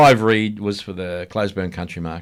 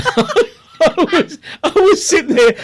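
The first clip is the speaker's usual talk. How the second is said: much higher, louder, speaking through laughter; laughing helplessly; 6 dB louder, slower than usual. Real, not acted. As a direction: barely get the words out through tears of laughter. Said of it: "oh, I had tears".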